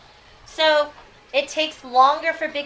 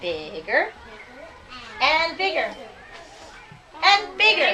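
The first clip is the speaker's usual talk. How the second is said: building bigger and bigger; 3 semitones higher, 4 dB louder, slower than usual. Acted. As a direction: swell it up as the pumpkin grows. Teacher reads pumpkin growing bigger.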